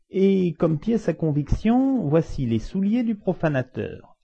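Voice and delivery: high, slow clerk's voice; officious clerk. Acted. Clerk presenting evidence to the court, high and officious. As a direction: announce it ceremoniously in a high, stretched voice.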